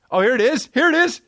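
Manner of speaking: high, excited cry of discovery. Real, not acted. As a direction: squeal it like you just found it. Excited finding the tweet.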